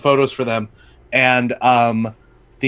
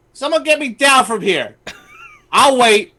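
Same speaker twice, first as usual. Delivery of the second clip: high and impatient, stranded midair; stuck up there and annoyed; Wanda Sykes as Storm; 9 semitones higher than usual. Retold or imitated. Comedian imitating Wanda Sykes stuck as Storm. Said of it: "stopping midway and go".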